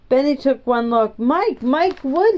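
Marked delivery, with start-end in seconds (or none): 1.2-2.4 higher, drawn-out call of recognition; storybook yell, raised in pitch only; mild narrated yell. A narrator's reading. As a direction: call the name out in surprise, without real volume. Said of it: "he yelled".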